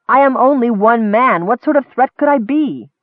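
high, rising disbelief; incredulous protest. Acted. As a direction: pitch climbing in exasperation. Errol protests his innocence.

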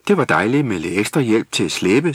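plain reading voice

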